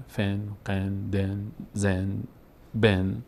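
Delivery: فن، قن، دن، زن، بن each closed with a nasal en; tanween en sounds. Shown as a demonstration. Teacher showing double kasra as en, spelled out slowly. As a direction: spell out فن، قن each with a short e and final n.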